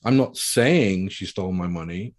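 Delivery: "saying" stretched and punched; slow down and hit "saying"; heavy emphasis on "saying"; stress on SAYING. Shown as a demonstration. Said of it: "I didn't actually say it".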